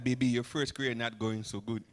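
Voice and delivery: lightly voiced Haitian mom. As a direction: hand him the job like it's nothing. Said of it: "I said".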